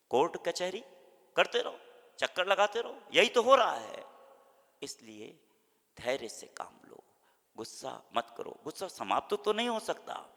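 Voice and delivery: ordinary delivery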